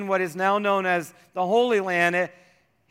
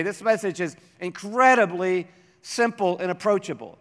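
normal talking voice

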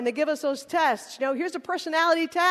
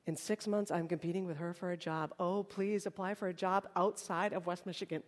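soft, lower-pitched aside; quiet inner thought; 7 semitones lower, 10 dB quieter than usual. Acted. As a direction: think it under your breath. Voices an anxious classmate's private thought.

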